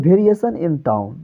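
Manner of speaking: slow, low demonstration of falling tone. Shown as a demonstration. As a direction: stretch it out slowly, letting the voice sink and soften. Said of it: "slow down में बोले".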